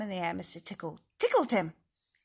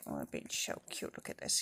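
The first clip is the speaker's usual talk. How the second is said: low breathy coo at puppies; hushed cooing; 7 dB quieter, faster than usual. Real, not acted. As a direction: coo softly at the pups.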